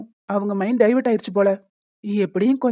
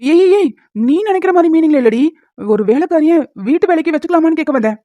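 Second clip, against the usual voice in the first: loud, higher-pitched hurried denial; flustered protest; 5 semitones higher, 5 dB louder than usual. Acted. Husband explaining the maid idea to his wife, flustered protest. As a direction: protest loudly, voice jumping up to clear a misunderstanding. Hastily protests wife's misunderstanding.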